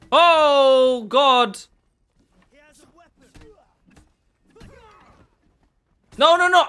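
high, loud oh God, then loud no no no; dismayed yell. Real, not acted. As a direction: cry out as your weapon gets taken. Another player took his pipe.

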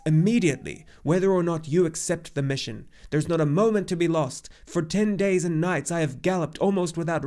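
loud, high, agitated tirade; indignant cry. A narrator's reading. Old centaur urging the boy to decide at once, indignant and overwrought. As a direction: press urgently, peaking then tiring slightly. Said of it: "cried Chiron indignantly".

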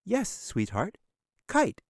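put-on high, sweet mom talking to baby; indulgent, smiling motherly singsong; pleasant smile. A narrator's reading. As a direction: coo it brightly to a little one. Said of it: "she said with a pleasant smile".

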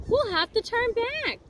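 high call over the storm. Acted. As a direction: call across the deck. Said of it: "called another".

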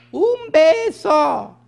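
slow, loud, drawn-out "¡un beso!" said in awe; emphatic awe. Acted. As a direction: stretch "un beso" slowly and loudly, amazed. Emphatic retelling of a kiss. ("¡un beso!" lento, fuerte y asombrado)

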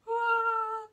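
sudden high loud sobbing wail; crying wail. Retold or imitated. Student breaking down as she opens her front door, wailing. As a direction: let it burst out as a sob at the door. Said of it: "I just cried".

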